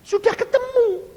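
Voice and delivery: high, loud outburst; emphatic shout. Acted. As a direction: cry it out at full volume. Preacher cries out the point. (seruan lantang bernada tinggi)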